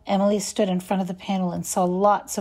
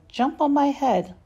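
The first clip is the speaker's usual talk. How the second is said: higher, lightly voiced friendly croc; friendly crocodile; 7 semitones higher than usual. A narrator's reading. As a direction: invite the duckling up, kind and a bit higher. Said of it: "the crocodile said".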